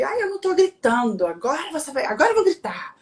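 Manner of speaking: high-pitched mock rant of a madwoman; imitating crazy yelling. Quarrelsome wife answering husband's plea not to yell, mock high-pitched yelling. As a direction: act out your old hot-headed self, voice shooting up high. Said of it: "eu era aquela louca".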